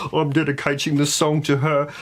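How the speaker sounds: high, fake-sobbing contestant; hammed-up weepy voice; mock tearful dedication. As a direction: blubber through a badly faked dedication. Mimicking tearful contestant dedicating song.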